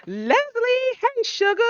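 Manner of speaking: light up as she greets her; gushing greeting. Real, not acted.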